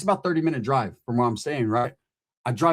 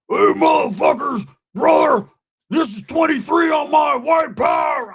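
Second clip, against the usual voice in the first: high, loud drawn-out yelling; hammed-up raging holler; screaming; 13 semitones higher, 6 dB louder, slower than usual. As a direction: imitate a friend yelling down the hall. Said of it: "fucking screaming, like, yelling".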